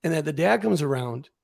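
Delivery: ordinary talk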